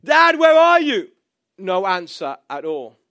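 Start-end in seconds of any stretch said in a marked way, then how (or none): 0.0-1.1 loud, high calls for Dad; lost child shouting for his father. Retold or imitated. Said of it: "I start shouting out".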